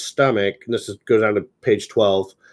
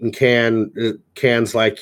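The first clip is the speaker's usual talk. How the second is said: usual speaking voice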